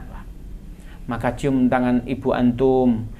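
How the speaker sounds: ordinary talk, nothing marked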